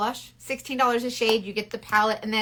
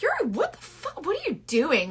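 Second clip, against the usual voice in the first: high-pitched retold bafflement; mock disbelief; 7 semitones higher than usual. Retelling her reply to the man, mock disbelief. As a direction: imitate someone baffled by him.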